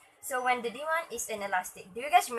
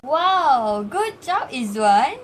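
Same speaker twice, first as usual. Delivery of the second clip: excited praise; 2 semitones higher, 10 dB louder, much slower than usual. Acted. Teacher praising a student's graph explanation, loud and excited. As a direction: cheer the student on loudly.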